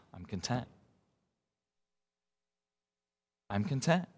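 softly, almost under the breath, at peace; quiet calm contentment. Pretend. Pastor imagining a listener who lost everything, quietly content. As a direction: say it quietly, settled and unbothered.

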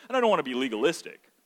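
normal talking voice

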